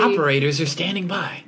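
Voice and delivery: high, cheesy announcer line; infomercial voice. Pretend. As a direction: sell it like a TV ad. TV ad parody.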